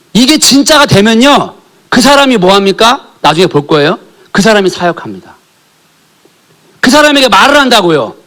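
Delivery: fervent sermon climax; full-force preaching. Acted. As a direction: preach it out at the congregation.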